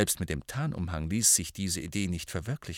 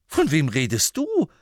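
higher, louder echo of the question; incredulous echo; 6 semitones higher, 6 dB louder than usual. Acted. Harry echoing Ron's question in loud disbelief. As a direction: throw the question back in disbelief. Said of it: "erwiderte Harry".

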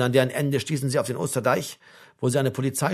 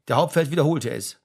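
usual speaking voice

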